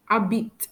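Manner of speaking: habite said "a-beet", no H; silent H. Shown as a demonstration. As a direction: start habite straight on the vowel. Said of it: "I don't pronounce the letter".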